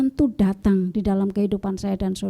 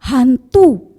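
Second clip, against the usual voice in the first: sudden startled cry, higher and louder; frightened cry; 6 semitones higher, 7 dB louder, much slower than usual. A narrator's reading. Disciples terrified at a figure on the water, crying out. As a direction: shout it out as if seeing a ghost. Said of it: "mereka terkejut dan berseru".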